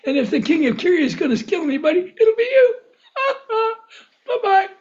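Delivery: high, laughing sly schemer; gleeful mockery. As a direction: rush it in a high voice, breaking into laughs. Voices Ahab's sly joke, laughing.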